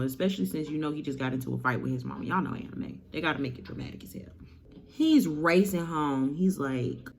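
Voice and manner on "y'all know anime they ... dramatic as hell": hushed conspiratorial whisper; whispered aside. Acted. Telling the audience anime is dramatic, whispered.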